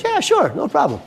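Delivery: very high, breezy quoted reply; high-voiced, easygoing retold agreement; friend's easy yes. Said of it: "And he goes".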